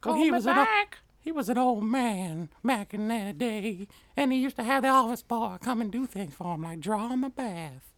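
put-on old man voice. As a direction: tell it like a creaky old man.

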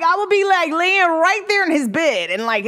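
usual speaking voice

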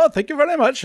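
high-pitched mock Indian accent. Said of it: "Go walk up to an Indian person and go".

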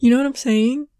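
slow, overdone mouthing of every word; exaggerated lip talker. Mocking her sister's overdone lip talk, slow and exaggerated. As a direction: stretch each word like you're mouthing it across a room. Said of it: "it just looks like they're like".

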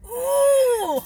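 high loud excited ooh. Real, not acted. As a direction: squeal with interest. Reacting to dark web topic.

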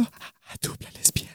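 very soft whispered reveal; hushed whisper. Real, not acted. Co-host telling whom the actress dubs, whispered. Teasing reveal of a dubbed role.

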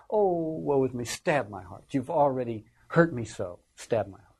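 hammed-up woe, sinking on the plea; mock the wounded friend's self-pity; melodramatic. Cassius pleading with Brutus, mock melodrama. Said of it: "Very melodramatic".

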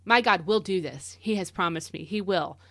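ordinary talk, nothing marked

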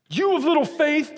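mock-furious Jesus scolding Peter; irate rebuke. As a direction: bark it like an angry preacher. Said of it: "Jesus is just so irate".

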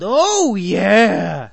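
drawn-out mock yell, high start falling away; retold yell. Fan showing how he yelled after the game, mock yell. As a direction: re-enact a victory yell without full volume. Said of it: "I yelled out pretty loud".

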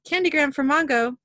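mock high voice; high-pitched voice. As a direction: pitch it up for a silly delivery. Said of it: "in a high-pitched voice".

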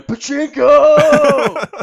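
wild, drawn-out high yell; Nicolas Cage. Retold or imitated. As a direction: holler it like an unhinged ad star. Imitates Nicolas Cage's insane ad cry.

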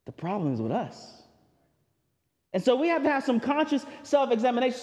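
ordinary talk

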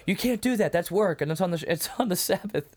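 a hammed-up scandalized objection; mock outrage. Pharisees scolding the healed man for carrying his mat, mock outraged. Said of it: "he's like".

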